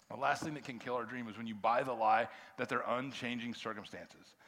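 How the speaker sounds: normal talking voice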